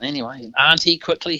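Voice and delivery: ordinary talk, nothing marked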